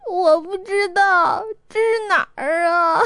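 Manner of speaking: high, slow tearful wail; lost and crying. A narrator's reading. Ge Yiqing lost in the street, crying to Chongwen. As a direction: cry it out, not knowing where.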